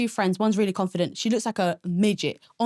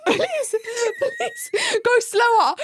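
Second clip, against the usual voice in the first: much higher, slow, half-laughing half-crying begging; laughing panicked plea; 13 semitones higher, much slower than usual. Retold or imitated. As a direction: beg the runaway bike to slow down, giggling in fear. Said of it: "I'm like".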